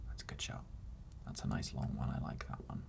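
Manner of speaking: quiet whispered aside. Real, not acted. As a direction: whisper it to yourself. Whispering over footage.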